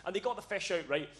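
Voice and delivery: normal talking voice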